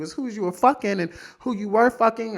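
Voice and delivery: ordinary talk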